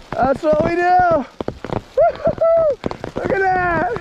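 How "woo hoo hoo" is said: high whooping cheer; gleeful whoop. Real, not acted. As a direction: hiker whooping in the hail, gleeful. Whooping in hail storm.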